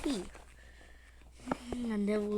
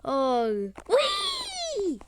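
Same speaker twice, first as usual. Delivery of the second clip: squeaky cartoon cat squeal, far higher; Banana Cat voice; 9 semitones higher than usual. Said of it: "Banana Cat says".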